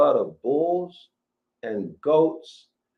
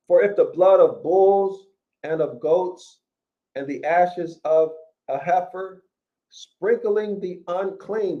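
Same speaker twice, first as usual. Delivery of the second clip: scripture reading; 4 semitones higher, 4 dB louder than usual. Acted. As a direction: proclaim each phrase like a preacher at the pulpit. Rereads verse, raised.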